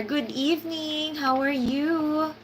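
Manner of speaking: ordinary talk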